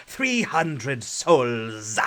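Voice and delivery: slow, drawn-out ominous line; dramatic movie quote. Acted. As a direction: stretch it out like a ghostly captain. Quoting a film line dramatically.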